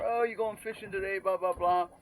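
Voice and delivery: high, loud mock tourist voice; mimicking another foreigner. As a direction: ham up a cheery fellow expat. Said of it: "this other Falong taps me".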